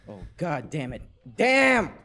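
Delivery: usual speaking voice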